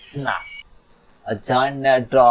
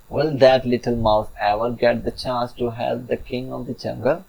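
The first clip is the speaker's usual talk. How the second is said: ordinary delivery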